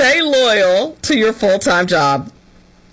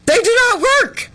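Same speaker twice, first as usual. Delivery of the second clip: much higher, incredulous squeak; mock outrage; 12 semitones higher than usual. Griping about her students, high mock disbelief. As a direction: sound scandalized at lazy students.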